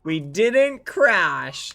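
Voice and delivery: slow, drawn-out, high and loud; teasing gloat. Real, not acted. Streamer teasing doubtful chat, slow loud gloat. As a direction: stretch every word to rub it in.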